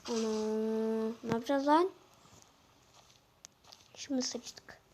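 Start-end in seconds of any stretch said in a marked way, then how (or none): 3.9-4.8 whisper. Real, not acted. Realizes trouble in game.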